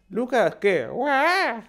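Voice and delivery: high-pitched mock baby voice, ending in a wail; baby imitation. Comedian mocking very young fans, exaggerated baby voice. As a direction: squawk like a baby. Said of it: "mi imitación de un bebé".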